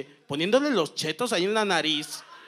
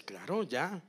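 holistic voice; 7 dB quieter than usual. Retold or imitated. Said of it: "con su voz holística".

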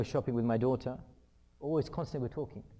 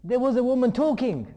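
very loud, high-pitched kid imitation; excited youngest child; 11 semitones higher, 14 dB louder than usual. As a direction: blurt it out like a proud little one. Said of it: "and my youngest".

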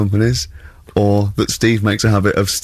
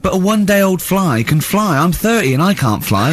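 Carl quote; 7 semitones higher than usual. Retold or imitated. [name] voices Carl's reasoning.

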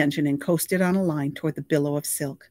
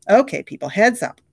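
loud call for attention; calling the crew; 5 semitones higher, 8 dB louder than usual. A narrator's reading. Director calling the crew to attention loudly. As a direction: get everyone's attention.

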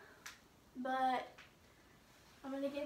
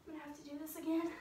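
normal talking voice

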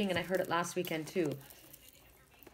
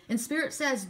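ordinary talk

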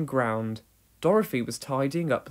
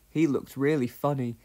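plain reading voice

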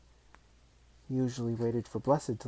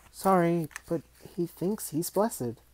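put-on higher, louder boy voice; apologetic boy; 7 semitones higher, 4 dB louder than usual. A narrator's reading. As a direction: blurt the apology. Billy apologises.

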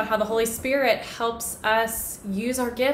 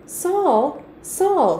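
raised, higher call of the name; loud heavenly voice; 7 semitones higher, 8 dB louder than usual. A narrator's reading. Loud voice calls to Saul on the road, raised calling. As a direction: call out firmly as a booming voice, mildly done. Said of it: "said the loud voice".

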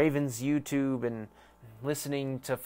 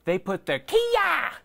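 much higher, slow, drawn-out pitch; squeaky ad voice; 4 dB louder, slower than usual. Acted. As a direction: stretch it up high like a cartoon jingle. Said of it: "That was a shit ad".

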